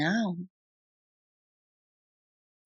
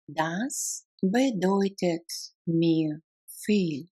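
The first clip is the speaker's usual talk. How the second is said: slow, careful, separated words. Shown as a demonstration. Teacher breaks down a German phrase, word by word. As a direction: pause between words for a learner. Language drill, broken into words.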